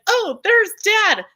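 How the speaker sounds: high, slow excited call; gleeful bull spotting his friend; happy bull voice. Retold or imitated. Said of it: "And he's like".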